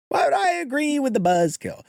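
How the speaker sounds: repeated up high and mocking; high-pitched retort. Real, not acted.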